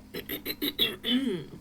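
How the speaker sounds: drawn-out, staged throat-clear; theatrical ahem; pointed throat-clearing. Acted. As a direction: clear the throat at length before making a point. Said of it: "I had to clear my throat".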